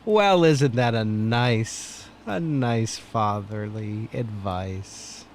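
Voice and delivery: slow, low mock approval; dry sarcasm. Real, not acted. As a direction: draw it out in sarcastic admiration. Mock praise of crude advice.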